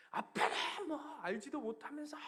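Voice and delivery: put-on high, loud defiant kid's yell; angry teenage son. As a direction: snap back like a furious teenager. Teenage son yelling at father.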